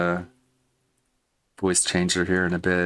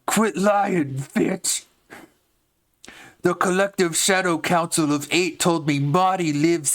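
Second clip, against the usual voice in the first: much higher angry goblin voice; 10 semitones higher than usual. Acted. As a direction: snap it out high as a mad goblin. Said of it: "Here's the Cyrax part".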